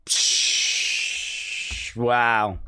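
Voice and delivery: usual speaking voice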